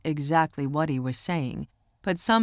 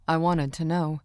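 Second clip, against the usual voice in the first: usual speaking voice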